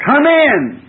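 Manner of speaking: high, loud welcoming call; southern come in. Retold or imitated. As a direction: call the stranger in without a second thought. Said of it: "You'd say".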